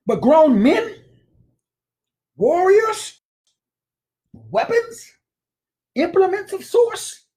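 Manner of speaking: high, loud preacher cry, slowing on implements of sorts; preaching build. Real, not acted. Preacher rousing listeners about grown men, high and loud. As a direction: rise up and thunder like a pulpit preacher.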